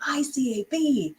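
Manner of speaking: high pitched, bee stressed; excited. Shown as a demonstration. Teacher showing an excited sentence with exclamation mark. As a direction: sound thrilled about the bee. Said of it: "If you're saying it with excitement".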